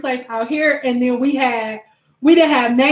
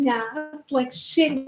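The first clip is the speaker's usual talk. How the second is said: normal talking voice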